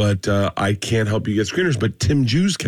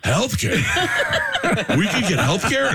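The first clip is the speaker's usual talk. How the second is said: higher, stunned disbelief; amazed comics; 10 semitones higher than usual. Retold or imitated. Comics stunned that a union brings healthcare. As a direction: gasp at the idea of healthcare.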